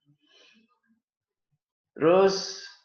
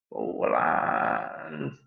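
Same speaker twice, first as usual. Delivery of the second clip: breathy whisper, like the exhale; whispered slowly; 5 semitones lower than usual. Shown as a demonstration. Teacher shows students the slow exhale in a whisper. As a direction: let the word out on the breath. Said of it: "hembuskan lewat mulut pelan-pelan saja".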